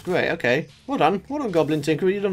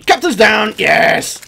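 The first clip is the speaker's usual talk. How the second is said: loud victory yell; whooping win; triumphant shout; 6 dB louder, slower than usual. Real, not acted. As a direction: cheer the kill. Cheering a boss kill.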